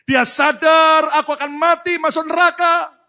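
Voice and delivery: high, full-force cry of realization; alarmed preaching shout. Acted. Mistress realizing she will die, alarmed shout. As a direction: shout the dread of damnation.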